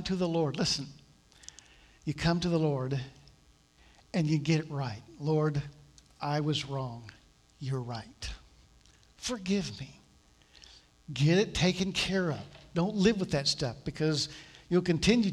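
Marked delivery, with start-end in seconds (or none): 5.2-10.0 a touch softer and lower prayer; humble confession. Retold or imitated. A sinner confessing to the Lord, softly. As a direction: say it like a contrite whisper to God.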